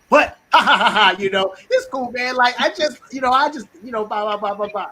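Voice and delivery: loud fake laugh into high, evasive chatter; sarcastic dodge. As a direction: laugh off the refusal with hammed-up sarcasm. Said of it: "it's really sarcasm".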